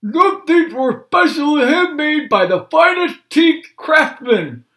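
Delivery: high, loud toothless voice; Grandpa talking funny. Acted. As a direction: talk like an old man without his dentures. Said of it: "Grandpa talking funny".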